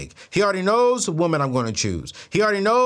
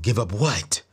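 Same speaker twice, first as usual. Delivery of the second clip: very low, mock-mysterious reply; mimicking the psychic; 10 semitones lower, slower than usual. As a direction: answer deep in her mystical manner. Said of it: "this is how I talk then".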